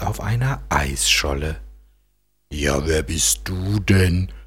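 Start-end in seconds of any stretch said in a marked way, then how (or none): 2.5-4.3 völlig verwundert. Acted. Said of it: "fragt der kleine Eisbär völlig verwundert".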